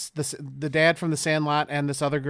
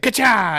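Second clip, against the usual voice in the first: gun cock sound; 6 semitones higher, 6 dB louder than usual. Retold or imitated. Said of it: "he's like".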